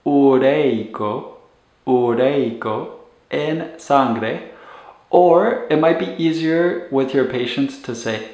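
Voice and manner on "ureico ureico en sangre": ureico said slowly with a rising end, twice; slow model word. Shown as a demonstration. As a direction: ureico drawn out for learners. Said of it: "in Spanish would be".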